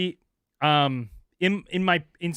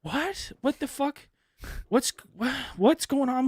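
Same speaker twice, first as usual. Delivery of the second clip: confused; 10 semitones higher, slower than usual. Retold or imitated. Own confused reply retold.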